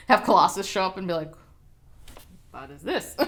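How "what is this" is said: lower, softer attempt at a Russian accent; botched mock Russian accent; badly faked Russian accent. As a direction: try a clumsy Russian accent for Colossus. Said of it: "That's not a Russian accent".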